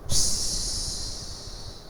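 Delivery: soft unvoiced shush; hushing psst. The chief hushes Jaromir in the cold night. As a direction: shush him quietly.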